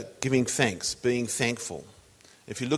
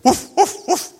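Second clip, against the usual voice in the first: high, loud imitated dog bark; 9 dB louder than usual. Dog answers butcher in joke.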